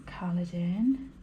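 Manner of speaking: whispered to herself; barely voiced murmur while concentrating. Real, not acted. Colouring in the tracker.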